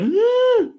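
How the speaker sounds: high, loud mock whimper; imitated whimper. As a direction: squeak out a frightened whimper. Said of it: "he just keeps that whimp".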